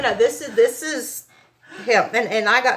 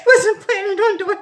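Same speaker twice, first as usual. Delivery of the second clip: crying through laughter; 8 semitones higher, 6 dB louder than usual. Real, not acted. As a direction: laugh and cry at once, overwhelmed. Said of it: "break down like that".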